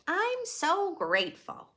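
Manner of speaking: high, bright-eyed delight; excited character voice. Acted. Clyde greeting the plate of donuts, delighted. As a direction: squeal over the treat. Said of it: "says Clyde".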